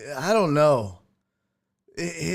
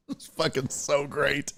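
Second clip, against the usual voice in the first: ordinary delivery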